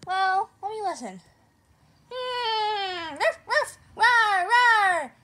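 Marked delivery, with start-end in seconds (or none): 2.1-5.1 barking like a dog. Acted. Imitating weird animal noises.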